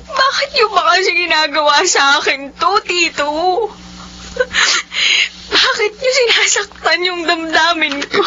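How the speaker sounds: sobbing accusation at her uncle; sobbing outburst at the uncle; crying outburst. Acted. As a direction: cry out the hurt.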